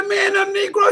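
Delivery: tearful high shout; choked shout. Retold or imitated. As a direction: cry it out high and loud. Emotional retelling of dad.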